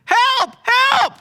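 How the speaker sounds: full panicked yell, very high and loud; a high, loud "Help, help!" screamed in panic; shout for help. Acted. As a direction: scream "Help!" with everything you've got. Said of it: "He said".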